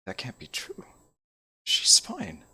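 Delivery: whispered denial; disbelieving whisper. Acted. Elrin hears the girl should be dead, whispering. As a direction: breathe it out in shock.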